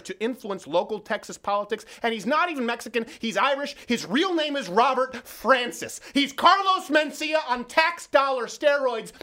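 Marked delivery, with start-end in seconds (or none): 2.0-9.1 heated. Real, not acted. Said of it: "I'm gonna get too heated".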